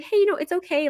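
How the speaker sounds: high, soothing reassurance; calm her down gently; reassuring. Retold or imitated. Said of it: "And I was like".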